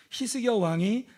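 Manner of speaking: ordinary talk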